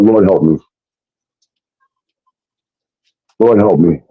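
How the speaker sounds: low, subdued repeated prayer; grave plea. Retold or imitated. Praying to the Lord as trouble dawns, grave. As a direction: plead low, pausing between the pleas.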